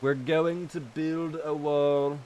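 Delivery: slow, half-hearted Trump impression; badly faked Trump accent, drawn out; attempted Trump accent. Streamer joking about a wall of Zomboids, attempted Trump accent. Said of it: "I can't do a Trump accent".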